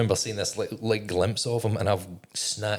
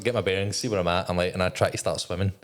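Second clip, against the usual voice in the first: usual speaking voice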